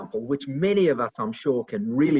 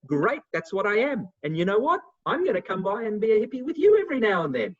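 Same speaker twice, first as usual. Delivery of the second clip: cheerful retort; 8 semitones higher, 6 dB louder than usual. Retold or imitated. Cheerful reply to hippie jab.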